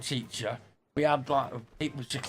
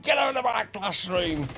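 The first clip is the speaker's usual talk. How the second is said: raised, higher pitched mock teacher bark; angry teacher; 6 semitones higher than usual. Teacher ordering a pupil out, mock fury. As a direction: throw the kid out of your class.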